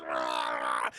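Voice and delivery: loud, very low guttural scream; long mock death growl; agonised groan. Justin's organs bursting, acted.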